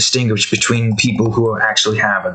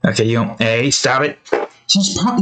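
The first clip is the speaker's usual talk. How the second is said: ordinary delivery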